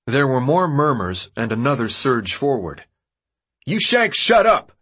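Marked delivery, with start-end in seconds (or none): 3.7-4.7 high, loud yell. A narrator's reading. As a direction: shout the crowd down. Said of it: "Albie yelled".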